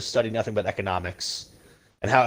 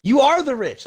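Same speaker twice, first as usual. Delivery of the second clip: exasperated retort; 9 semitones higher than usual. Retold or imitated. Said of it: "And I was like".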